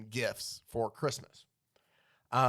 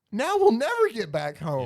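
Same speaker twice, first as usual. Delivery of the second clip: louder, higher wail of despair; mock despair; 8 semitones higher, 9 dB louder than usual. Host voicing stranded comic characters, hammed-up loud lament. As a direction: wail it like all hope is gone. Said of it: "They're just like".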